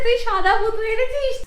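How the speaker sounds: put-on high, laughing toddler voice; mother copying her toddler, laughing; imitating the child. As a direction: mimic the little one through laughter. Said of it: "বলছে".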